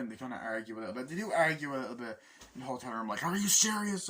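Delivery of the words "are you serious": high, loud indignant question; arguing in disbelief; mock angry are you serious; exasperated argument. Couple arguing in hotel.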